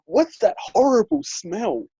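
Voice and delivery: high, slow imitation of appalled nan; disgusted. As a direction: draw it out in mock disgust. Nan reacts with disgusting face.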